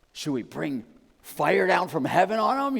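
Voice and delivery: high, loud mock zealous disciple; hammed-up dead seriousness. Preacher imitating a disciple asking Jesus, hammed-up dead serious. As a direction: overplay an earnest, outraged suggestion. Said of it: "they're, they're dead serious".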